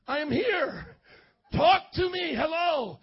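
high, loud, insistent protest; acted, indignant plea for attention; exasperated reply. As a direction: call out to someone ignoring you. Voices Nancy answering being ignored.

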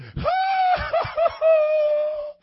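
loud high burst of mocking laughter. Preacher acting out Juan's table laughing at him, loud and high. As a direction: cackle loud and high at the idea. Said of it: "everybody at Juan's table went".

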